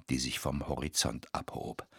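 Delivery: ordinary delivery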